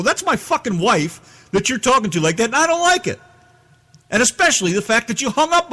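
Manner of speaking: high, heated retold yelling; angry. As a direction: defend his wife in fury. Retold angry phone rant.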